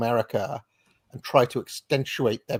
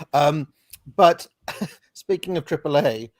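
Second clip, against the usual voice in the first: ordinary talk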